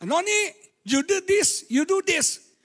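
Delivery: high, loud parent giving orders; commanding parent. Retold or imitated. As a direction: bark orders at the child. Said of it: "coba anak sekarang diperintahkan".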